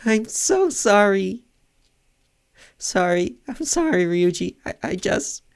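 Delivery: high-pitched, tearful, faltering apology; distraught apology. Acted. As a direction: apologise as if about to break down crying. Character breaks terrible news, apologising.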